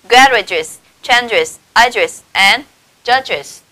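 "Garages, changes, ages and judges", final syllable clearly sounded; careful listed demo of "Garages, changes, ages and judges"; IS endings after GE. Shown as a demonstration. Teacher modelling ge-words, "Garages, changes, ages and judges" with extra final syllable. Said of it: "chúng ta sẽ đọc như thế nào".

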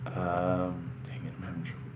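fast, nearly silent whisper to himself; muttered aside. Real, not acted. Fumbling with the device, a fast whispered mutter to himself. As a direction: mutter it under your breath in a rush.

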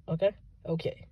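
usual speaking voice

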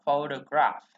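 "photograph" stressed on PHO, middle O reduced to uh; first-syllable stress. Shown as a demonstration. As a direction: model "photograph" as FOH-duh-graf. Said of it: "nó chuyển về ơ".

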